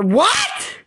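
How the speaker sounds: very high, very loud outraged shriek; shrill enraged scream; shrieking what. Real, not acted. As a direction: scream the word in disbelief and fury. Enraged outburst mid-rant.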